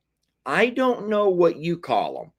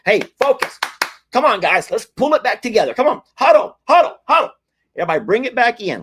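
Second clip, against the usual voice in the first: much higher and louder, calling everyone to huddle; rally the group back like a coach with a whistle; playful coach shout to the chat to huddle up; 9 semitones higher, 7 dB louder, faster than usual. Acted.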